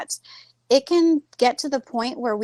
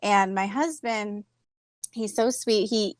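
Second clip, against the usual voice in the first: usual speaking voice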